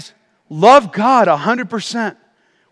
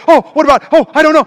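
higher, louder mock fluster; fearful; 6 semitones higher, 5 dB louder, much faster than usual. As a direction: imitate everybody fretting in a panic. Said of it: "There's just so much fear".

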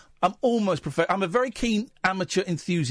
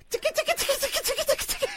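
giggly tickle attack; much faster than usual. Pretend. Tickling the caller on air.

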